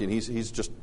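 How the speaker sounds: normal talking voice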